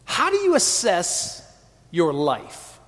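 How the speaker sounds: ordinary talk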